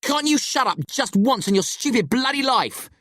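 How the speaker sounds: high, strained furious shout; angry roar. A narrator's reading. Friend tells Locke to shut up, roaring. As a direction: roar it, pitched right up. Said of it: "I roar".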